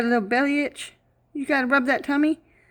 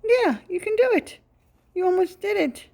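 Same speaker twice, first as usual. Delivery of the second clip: high excited praise; 7 semitones higher than usual. Real, not acted. Encouraging the bird.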